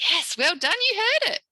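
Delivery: put-on high, loud, sing-out cheer for little kids; teacher cheering on little pupils; delighted praise. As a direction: praise them like small children.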